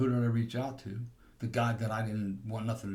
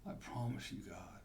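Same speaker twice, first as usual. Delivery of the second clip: soft, pleading prayer under the breath; desperate prayer; 10 dB quieter than usual. Retold or imitated. Drunk in his truck, praying softly to God, pleading. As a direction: beg God quietly, afraid of jail. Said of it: "I'm in the back of my truck praying".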